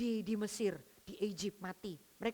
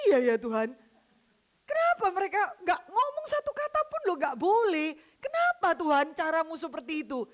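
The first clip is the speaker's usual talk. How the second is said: pleading question; 5 semitones higher, 6 dB louder than usual. Retold or imitated. Preacher's own question to God.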